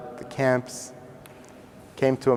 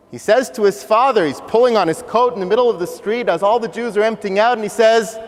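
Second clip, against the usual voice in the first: normal talking voice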